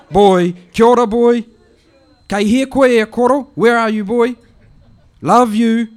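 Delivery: put-on high, loud calling out to the boy; an old auntie hollering at an answer phone; high loud auntie voice shouting for her nephew. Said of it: "it goes like this".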